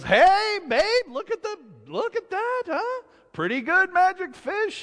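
very high, gleeful crowing; proud showing off. Acted. Husband shows wife the new washbasin, high-pitched boasting. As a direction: call her over to admire it.

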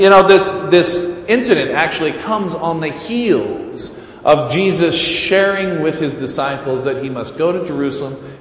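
ordinary talk, nothing marked

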